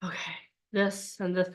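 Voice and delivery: low, soft mock mumble of someone memorizing; low muttered studying voice; mock staring mumble. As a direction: drop into a dull murmur, staring at the page. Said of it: "be like".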